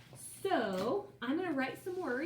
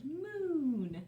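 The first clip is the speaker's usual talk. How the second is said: ordinary talk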